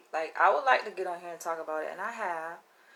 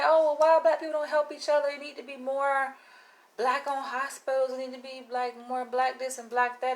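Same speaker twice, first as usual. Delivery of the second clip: raised, mocking imitation of critics; mocking what people say; 6 semitones higher than usual. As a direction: mimic the critics in a high, scornful voice. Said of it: "people say".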